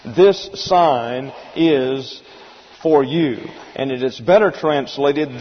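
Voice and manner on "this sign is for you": slow "This sign is", then a pause before "for you"; deliberate, spaced-out translation. Shown as a demonstration. Teacher restating the corrected translation, slow and deliberate. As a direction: draw out "This sign is" and set "for you" apart. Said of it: "here's how we're going to translate".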